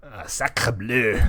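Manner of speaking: hammed-up French sacre bleu; French exclamation. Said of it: "mild profanities in French".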